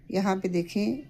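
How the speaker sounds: usual speaking voice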